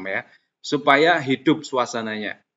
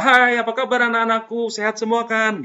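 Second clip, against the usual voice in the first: higher, friendly teacher voice greeting the class; cheerful teacher greeting; 8 semitones higher than usual. Acted. Teacher asking the class how they are, cheerful and high. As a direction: greet your pupils as a bright, caring teacher. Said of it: "menanyakan kabar siswa".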